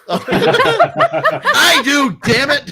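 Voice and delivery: high, loud protest with laughter; laughing, indignant yell; mock-indignant shout. Real, not acted. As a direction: shout back in playful outrage. Comeback to "who cares?.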